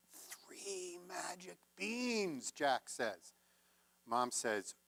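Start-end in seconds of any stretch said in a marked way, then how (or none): 0.1-2.5 very slow, drawn-out proud announcement; proud reveal. Acted. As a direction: savor each word like a treasure. Jack proudly reveals the beans.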